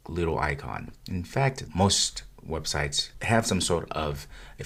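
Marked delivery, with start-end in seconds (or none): none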